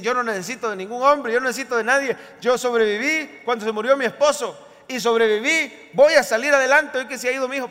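mock defiant, quickening at the end; defiant self-sufficiency. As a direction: act out a proudly independent woman. Acts self-sufficient women's defiant speech.

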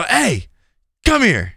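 high-pitched, sharp summons; calling out. Retold or imitated. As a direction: call someone back as they walk off. Calling after supervisor walking away.

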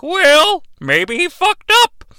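high squeaky henchman; Piggy voice. Acted. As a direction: squeak it like a dim sidekick. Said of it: "Piggy and Goldar are like".

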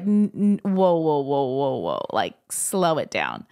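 imitated "whoa" tumbling down in pitch, then slow; halting, slowing down. Pastor stopping her from quitting, imitated slow-down. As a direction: rein someone in, ending low and drawn out. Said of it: "he stops me and he's like".

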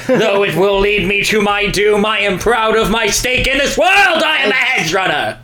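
hammed-up noble hedgehog proud of his fate; mock heroic hedgehog. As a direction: declare your destiny like an epic hero. Parodying the hedgehog's noble speech.

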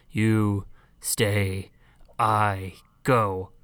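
low, halting giant impression; giant robot voice. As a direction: say it low, one slow word at a time. Quoting the giant again.

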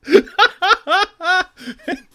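loud, high-pitched cackling laugh; long peal of real laughter; big laugh. Real, not acted. Hosts crack up at greetings.

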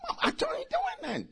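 high, soft innocent denial; innocent son. Retold or imitated. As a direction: play the boy feigning innocence to mama. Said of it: "I said".

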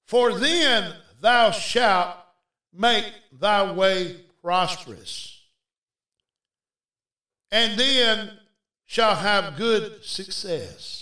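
slow, measured Bible reading; scripture reading. Real, not acted. Preacher reading scripture to the congregation, slow and deliberate. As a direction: read the verse out deliberately, phrase by phrase.

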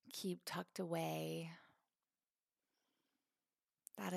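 calm, spacious phrases with long pauses; guided meditation. Acted. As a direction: gently guide them into letting go.

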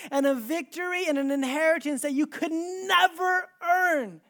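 high, loud preaching with drawn-out key words; impassioned proclamation. Retold or imitated. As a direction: proclaim it with full force to the congregation. Jesus's words preached at full force.

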